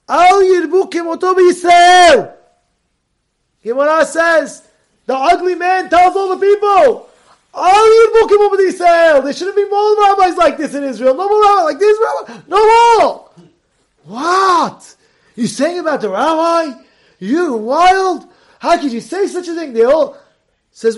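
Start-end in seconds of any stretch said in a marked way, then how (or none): 0.1-13.3 high, loud, crying-out proclamation; outraged shout. Retold or imitated. Ugly man shouting to all the people against the rabbi. 14.0-19.7 high, loud outraged questions; shocked indignation. Retold or imitated. Townspeople outraged at the insult to their rabbi.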